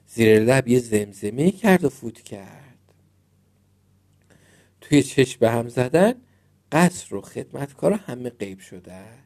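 ordinary delivery

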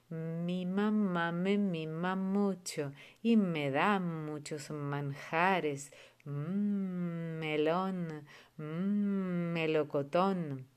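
slow, drawn-out chant, every M sound lingered; slow rhyme recital. Shown as a demonstration. Teacher demonstrating the M rhyme for parents, slow and lingering. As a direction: recite it slowly so each M can be heard. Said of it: "hay una rima, la de la M".

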